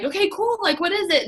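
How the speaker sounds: high, bright eager reply; excited reply. Retold or imitated. Prospect answering a sales message, eager and high. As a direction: perk up like a curious new prospect.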